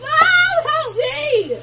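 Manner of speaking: panicked shout for help; scream. Real, not acted. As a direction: cry out in alarm. Water in ear, kid screams.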